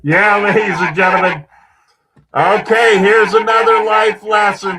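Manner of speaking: booming showman announcement; loud announcer shout. Real, not acted. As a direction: shout it out to the crowd. Host loudly announces to audience.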